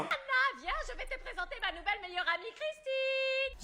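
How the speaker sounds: put-on high sing-song old woman; old lady voice. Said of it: "la voix de la vieille Morning Star".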